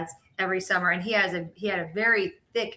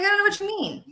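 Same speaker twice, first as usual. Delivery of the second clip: high-pitched Southern denial; Southern accent; 11 semitones higher, faster than usual. Retold or imitated. Child denies it to mom, high Southern accent. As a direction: plead innocence in a Georgia twang. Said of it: "I would have a Southern accent".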